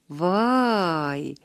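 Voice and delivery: long drawn-out wondering wow; amazed wow. A narrator's reading. Houman sees the ground full of kites, drawn-out wow. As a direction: stretch it out in awe.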